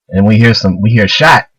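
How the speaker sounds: normal talking voice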